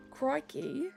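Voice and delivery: normal talking voice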